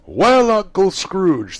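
high, loud, slow group toast; cheering toast. Acted. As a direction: raise the glass and cheer. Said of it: "they cried".

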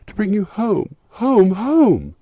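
put-on very high, loud child's squeal of joy; gleeful little girl. A narrator's reading. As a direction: cry it out as an overjoyed little sister. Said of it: "brimful with glee".

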